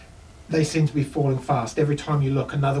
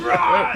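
battle cry; 13 semitones higher, 6 dB louder than usual. Acted. Dwarf charges in.

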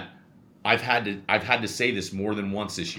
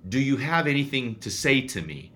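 retold challenge; 5 semitones higher than usual. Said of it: "I said".

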